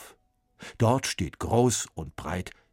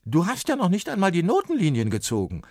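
loud, higher, fast telling off; scolding; 12 semitones higher, 7 dB louder, faster than usual. A narrator's reading. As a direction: scold the boy sharply. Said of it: "schimpft er".

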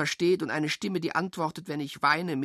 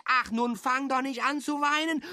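much higher sing-song comfort; mock soothing; 11 semitones higher than usual. Teasing a tearful child in high sing-song. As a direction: pretend to hush a child in a high voice.

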